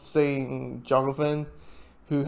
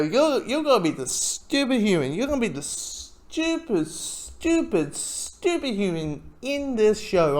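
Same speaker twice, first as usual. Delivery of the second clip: mock exasperation; 6 semitones higher than usual. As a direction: lean on "stupid" in mock despair. Teasing a character as stupid.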